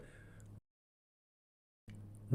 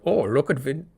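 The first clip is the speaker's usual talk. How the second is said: bad Nordic accent slipping into German; louder, higher, botched Nordic accent; terrible Nordic accent; 4 semitones higher, 4 dB louder than usual. Comic mocking smug Nordic countries, terrible Nordic accent. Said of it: "that's a terrible accent".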